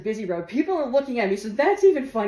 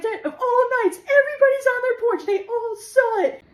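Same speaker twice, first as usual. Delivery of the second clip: higher and louder, near laughing; high hysterical telling; 14 semitones higher, 5 dB louder than usual. Retold or imitated. Daughter telling dad, high and hysterical. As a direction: blurt it to dad in disbelief.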